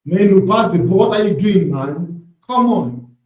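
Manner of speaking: loud exasperated shout at the screen; frustrated yelling. Real, not acted. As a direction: bark at the player. Yelling at player on TV.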